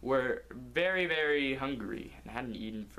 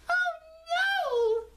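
squeaky high shriek, much louder; panicked little cone; 7 dB louder than usual. Acted. Barrick spat out by the salesman, squeaky panicked shriek. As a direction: cry out in cartoon panic. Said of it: "Barrick cried".

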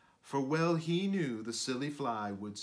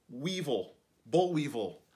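ordinary delivery, nothing marked

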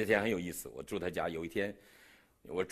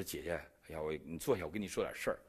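hushed confiding; 7 semitones lower, 7 dB quieter than usual. Retold or imitated. Confessing secretly to his host.

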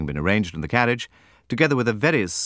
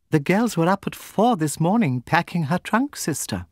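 much higher voice for the younger sister; Jemima's voice; 10 semitones higher than usual. A narrator's reading. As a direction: pitch it up high for Jemima. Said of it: "Jemima answers her sister".

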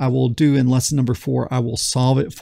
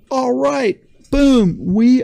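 much higher, triumphant boom; excited; 10 semitones higher, 4 dB louder, slower than usual. Real, not acted. Teacher cheering his working program, excited. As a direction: celebrate the win out loud.

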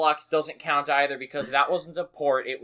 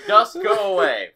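high, loud, stretched-out yell; re-enacted shout; 7 semitones higher, 10 dB louder than usual. Player yelling into the mic at fingerprints, re-enacted shout. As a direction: bellow it at the dust. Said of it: "and I just went".

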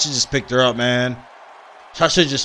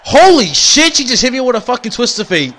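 surprised; 7 semitones higher than usual. Real, not acted. Losing a match in game.

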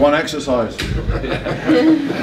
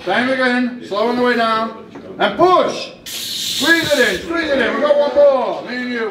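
coach shouting cues; 11 semitones higher, slower than usual. Real, not acted. Coach cueing a lift.

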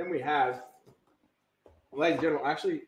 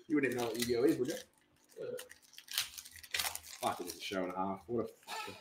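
ordinary delivery

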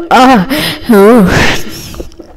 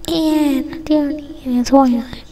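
hushed, breathy aside; whisper; 4 semitones higher, 9 dB quieter, slower than usual. Pretend. Kid whispering to a toy student about Toyland, whisper. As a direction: whisper it as a secret.

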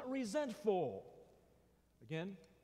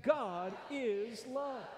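higher, slow, emphatic chant; said like you mean it; 6 semitones higher, much slower than usual. Shown as a demonstration. Preacher urging the congregation to mean it, emphatic. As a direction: push it out with conviction. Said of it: "say it like you mean it".

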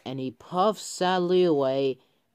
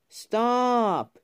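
high-pitched cry of warning; 7 semitones higher, 4 dB louder than usual. A narrator's reading. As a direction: call out to halt someone, high. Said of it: "cried Peyton".

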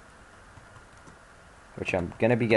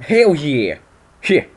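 hyped cheer; 5 semitones higher, 7 dB louder, much slower than usual. Real, not acted. Gamer hyped for next gym.

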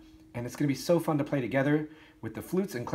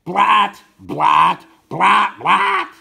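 blatting horn imitation, far too loud; ugly loud blast; 8 semitones higher, 14 dB louder, much slower than usual. Shown as a demonstration. Band teacher showing how not to play, ugly loud blast. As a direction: blare each note harshly, out of control. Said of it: "so loud that it sounds ugly".

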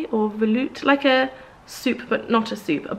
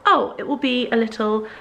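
mock dainty; 5 semitones higher than usual. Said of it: "I thought".

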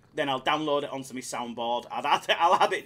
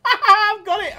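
loud, high burst of laughter; laughing with glee; 12 dB louder, slower than usual. Real, not acted. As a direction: crow it through a real laugh. Gleeful at getting the voice.

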